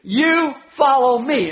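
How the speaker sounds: YOU, FOLLOW, ME each hit hard and slowly; emphatic. Acted. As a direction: punch "You follow me" word by word, high and loud. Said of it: "that's emphatic".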